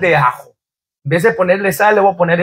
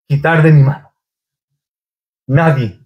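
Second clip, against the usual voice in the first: normal talking voice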